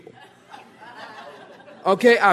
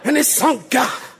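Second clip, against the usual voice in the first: mock preaching voice; 3 semitones higher than usual. Preacher keeps joking with Peter sinking, mock pulpit cadence. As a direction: playfully punch it like a revival preacher. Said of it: "I'm gonna start preaching that way".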